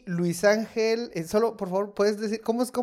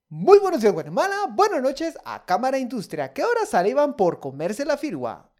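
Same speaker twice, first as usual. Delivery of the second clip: loud, high announcer opening; radio announcer; 6 semitones higher, 4 dB louder than usual. Acted. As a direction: boom out the show intro. (locutor de radio animado)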